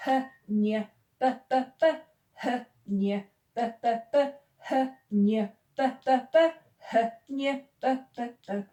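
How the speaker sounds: chanted phonics drill, "H" softened to an English h; rhythmic sound drill. Shown as a demonstration. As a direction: beat out "H, nie" as breathed "huh, nyuh", then three "buh"s for "p, p, p". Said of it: "So this goes".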